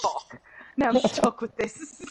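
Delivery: high, loud mock dismay; exasperated outburst. Player recalling an accent that slipped out, exasperated. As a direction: blurt it out in comic exasperation.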